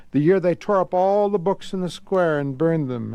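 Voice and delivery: usual speaking voice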